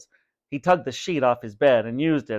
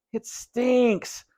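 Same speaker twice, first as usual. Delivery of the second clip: wail; 10 semitones higher than usual. A narrator's reading. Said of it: "Vinny wailed".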